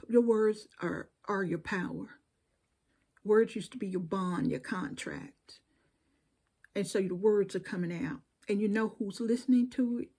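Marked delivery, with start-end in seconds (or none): none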